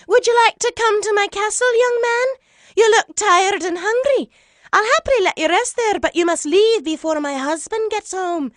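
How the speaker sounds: high, loud motherly giant voice; high-pitched, booming hospitality; kindly giantess. A narrator's reading. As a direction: invite him in like a big friendly giantess. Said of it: "a kindly giantess".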